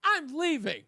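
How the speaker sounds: high, falling mock announcement; mock walkout threat. Host mimicking a chat room member, mock-dramatic threat. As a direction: mimic someone dramatically quitting. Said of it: "in the chat room".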